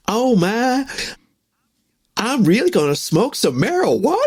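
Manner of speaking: put-on a Southern girlfriend, a touch of wide-eyed wonder; Southern chick. Friend's dad's girlfriend about to try it, mild Southern accent. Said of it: "She was like this Southern chick".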